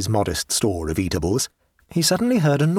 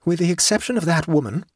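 higher, dramatic self-talk; raised-pitch dramatic musing; dramatic exclamation; 6 semitones higher than usual. A narrator's reading. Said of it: "he exclaimed dramatically to himself".

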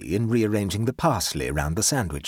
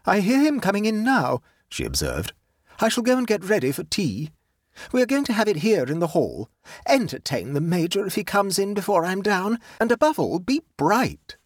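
higher-pitched hostess voice, giving instructions; brisk commanding aunt; 9 semitones higher than usual. Acted. Aunt instructs her niece before tea, brisk and commanding. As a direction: play the aunt laying down orders.

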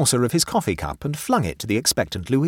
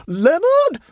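very high, loud scream of a name; shrill scream; 9 dB louder than usual. A narrator's reading. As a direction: scream shrilly at your nephew in panic. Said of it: "she screamed shrilly to her nephew".

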